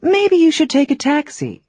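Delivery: "Maybe" lifted high, gentler suggestion; softened advice. Shown as a demonstration. Teacher softening advice to a friend going to the airport, gentle. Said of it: "You can soften that advice with maybe".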